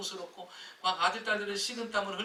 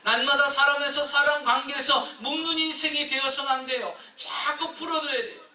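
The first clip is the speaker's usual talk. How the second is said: loud, high, fervent exhortation; fervent preaching; 9 semitones higher, 12 dB louder than usual. Acted. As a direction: preach it out with full force. Preacher at full force. (열정적인 설교)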